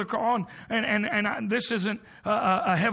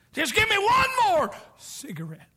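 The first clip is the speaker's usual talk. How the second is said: high pleading voice, stretched out; dying man's plea; 11 semitones higher than usual. Retold or imitated. Dying man begging for a last cigarette, high plea. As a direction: beg for it in a high voice. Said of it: "say something like this".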